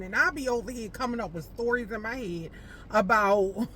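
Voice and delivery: ordinary talk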